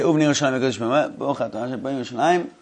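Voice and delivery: fast soft murmured prayer; quick quiet recitation. Shown as a demonstration. Rabbi showing his class how to recite the blessing, a fast soft murmur. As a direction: rattle the blessing off fast under the breath. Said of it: "So you say".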